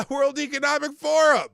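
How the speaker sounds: high, loud punchline reveal; mock exclamation. Host revealing the proposed regulator, loud mock astonishment.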